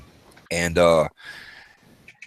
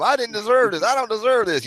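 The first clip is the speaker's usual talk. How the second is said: inner whine; 12 semitones higher than usual. Retold or imitated. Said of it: "thinking the whole time".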